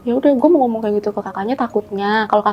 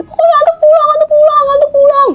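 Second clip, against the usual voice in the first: put-on high, loud whiny child's crying wail; imitating child crying; 5 dB louder than usual. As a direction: mimic a toddler sobbing and refusing. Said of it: "dia nangis kejar". (tiruan anak kecil menangis merengek, suara tinggi dan keras)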